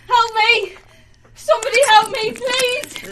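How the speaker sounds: desperate shouts for help; screaming for help. Acted.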